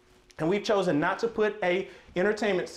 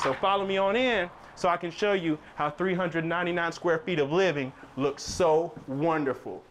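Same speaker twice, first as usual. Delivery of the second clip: ordinary talk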